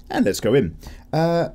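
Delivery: ordinary talk, nothing marked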